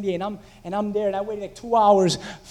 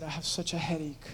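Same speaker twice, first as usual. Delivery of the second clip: drained; 5 semitones lower, 11 dB quieter than usual. Retold or imitated. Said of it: "and I said".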